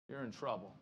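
low, soft, grave You're in trouble; quiet warning. Shown as a demonstration. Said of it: "low quiet warning".

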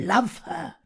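slow, intense reading with long pauses; Miss Havisham. Acted. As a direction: read it as a bitter, obsessed jilted bride. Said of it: "Miss Havisham is Dickens".

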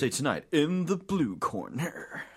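slow, drawn-out fight announcer call; ring announcer. Acted. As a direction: introduce the blue corner like a boxing MC.

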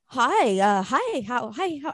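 imitating his halting phone greeting; put-on phone voice, stumbling over hellos. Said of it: "he always has this voice".

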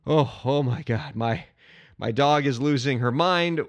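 winded after belting a song; exhausted, out of breath. Real, not acted. Fan recovers from singing the fight song, real breathlessness. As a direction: catch your breath between broken, low phrases. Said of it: "I'm exhausted. I need a".

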